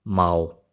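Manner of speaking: माओ said once as the sample word; tone demo. Shown as a demonstration. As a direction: give माओ as the example. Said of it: "अब जैसे एक ये word है". (उदाहरण शब्द माओ)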